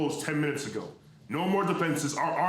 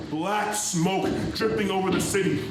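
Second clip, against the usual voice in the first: higher and louder, building to terror; rising panic; 5 semitones higher, 3 dB louder than usual. Acted. Rooftop announcer describing the smoke reaching the crowds, growing panic. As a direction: voice climbing as the smoke closes in.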